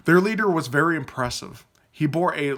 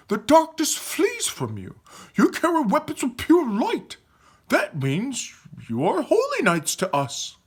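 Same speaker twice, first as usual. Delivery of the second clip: raving; 11 semitones higher than usual. Acted. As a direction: rave with awestruck conviction. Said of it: "Aaron raved".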